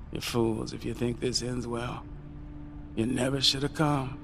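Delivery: breaking bad news quietly; Uncle Jim, hopeless. Uncle Jim's bleak warning.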